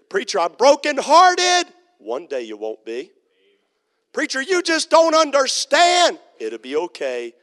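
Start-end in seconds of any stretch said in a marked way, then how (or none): 0.1-1.7 high, loud, slow wail; grieving congregant. Acted. A mourner crying out to the preacher, high and loud. 4.1-6.2 high, loud, slow wail; grieving congregant. Acted. A mourner crying out to the preacher, high and loud.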